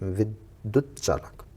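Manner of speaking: विद्युत चालक slowed, with a half चा before the full चा; slow careful pronunciation. Shown as a demonstration. Teacher to students, slowly repeating the correct विद्युत चालक. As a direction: say the right spelling carefully. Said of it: "सही वर्तनी है".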